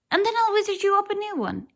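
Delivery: quick excited wizard brag; boastful rush. Acted. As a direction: rattle it off like a show-off wizard. Wizard boasts he'll magic cake.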